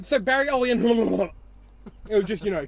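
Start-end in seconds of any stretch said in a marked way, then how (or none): 0.0-1.4 higher, louder mock turkey gobble; turkey noise. Retelling the friend's misreading, mock turkey gobble. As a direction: gobble the last name like a turkey. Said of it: "turkey noises".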